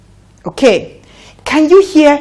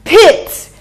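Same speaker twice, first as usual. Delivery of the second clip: pit lifted high and clear; bright teacher model; 9 semitones higher than usual. Shown as a demonstration. Teacher repeating pit brightly for children. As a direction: call pit out high once more. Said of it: "Once again".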